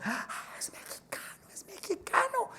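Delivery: whispered, rising in wonder; awed whisper. Acted. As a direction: murmur it in amazement, like a crowd. Crowd whispering in awe. (susurro asombrado de la multitud)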